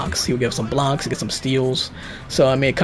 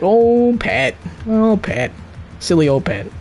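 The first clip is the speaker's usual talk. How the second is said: fond teasing; 8 semitones higher, slower than usual. Retold or imitated. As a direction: tease him like a lovable pest. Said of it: "it's kinda like".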